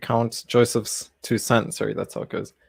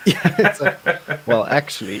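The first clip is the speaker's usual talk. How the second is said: laughing; 5 semitones higher, 6 dB louder, slower than usual. Real, not acted. Teasing joke, laughing through words.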